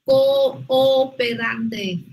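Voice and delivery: "Cooperante" very slow, the double o held long; teacher stretches "Cooperante" to show the hiatus; slow model pronunciation. Shown as a demonstration. Teacher models the word slowly for Queen. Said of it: "Otra vez".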